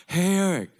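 high call to his son; dad calling out. Retold or imitated. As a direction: imitate the dad's call across the room. Dad calling across waiting room.

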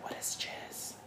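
unvoiced secret whisper; whispered question. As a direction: whisper it so the kids can't hear. Mom asks secretly.

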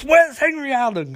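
high-pitched, hammed-up interrogation; mock angry demand. Barry pins Trickster against the wall, mock angry demand.

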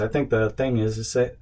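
usual speaking voice

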